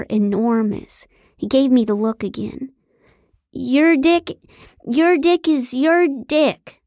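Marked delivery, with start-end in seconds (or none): 3.5-6.8 high, stretched, hammed-up cry; faked passion. A narrator's reading. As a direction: fumble through a mock-ecstatic shout. Faking loud sex for cameras.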